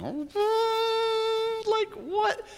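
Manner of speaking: very high squeak of a confused mind; stunned disbelief. Retold or imitated. Mary's mind reeling at seeing Jesus, high baffled squeak. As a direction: voice her inner shock, up high. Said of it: "Her poor little brain must have been".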